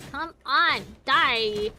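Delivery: loud, drawn-out urging; frustrated yell. Real, not acted. Player willing the enemy to die, frustrated yell.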